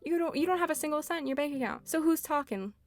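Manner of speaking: high, quick taunting jab; mocking comeback. Hitting back at Lloyd who has no money either, taunting and high. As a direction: fire the insult right back at him.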